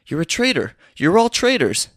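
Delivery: rising to a high, loud accusing cry; mock accusation. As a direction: build to an outraged accusation. Gates accuses the man.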